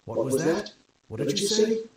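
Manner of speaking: mildly angry lieutenant demanding; incensed. A narrator's reading. Lieutenant wheeling round on Pretzel, mildly incensed. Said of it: "Lieutenant Jackson is incensed".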